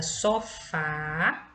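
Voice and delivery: "sofá" with a drawn-out final FÁ; teacher sounding out "sofá", weight on the end; stress on last syllable. Shown as a demonstration. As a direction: lean on the FÁ of "sofá". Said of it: "a última sílaba é pronunciada com mais intensidade".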